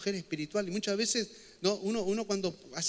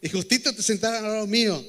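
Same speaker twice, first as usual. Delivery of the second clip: louder, playful jab at a listener; teasing call-out; 4 semitones higher, 8 dB louder than usual. Acted. Pastor teasing a congregant who sat beside him, loudly. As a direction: call it out across the room, joking.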